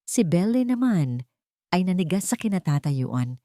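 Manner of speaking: plain reading voice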